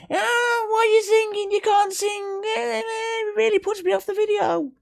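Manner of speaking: very high whiny mock voice; mocking commenter. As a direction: sneer like a snooty commenter. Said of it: "people leave comments on YouTube".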